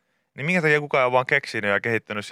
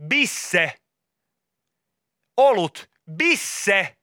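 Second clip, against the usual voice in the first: retold shout; 7 semitones higher, much slower than usual. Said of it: "huutaa sille tarjoilijalle".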